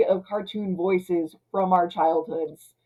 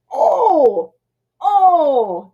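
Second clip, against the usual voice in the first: delighted gasp; 8 semitones higher, 11 dB louder than usual. Real, not acted. Reacting to a great line.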